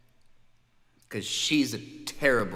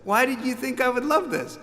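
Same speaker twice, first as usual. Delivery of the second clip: high incredulous question; baffled; 11 semitones higher than usual. Retold or imitated. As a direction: ask it in disbelief. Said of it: "I was like".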